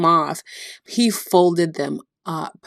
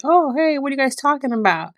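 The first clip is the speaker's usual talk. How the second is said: a higher, breezy "oh hey", with the question rushed; playful Jesus; 7 semitones higher than usual. Retold or imitated. Jesus greeting the two disciples on the road, higher, playful and innocent. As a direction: play innocent, casually joining the walk. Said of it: "Jesus is like".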